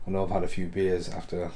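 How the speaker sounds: ordinary talk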